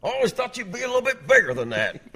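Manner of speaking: high, loud comic impersonation; Tim Wilson. Retold or imitated. Towering comic sizing up the radio host, loud impersonation. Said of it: "pretty good Tim Wilson impersonation".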